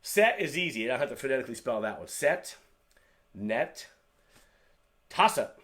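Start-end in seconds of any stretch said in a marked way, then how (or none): 5.1-5.5 "Tasset" said TAH-suht, loud; Korean pronunciation. Shown as a demonstration. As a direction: model "Tasset" slowly for the class. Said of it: "As you can see, toss ut".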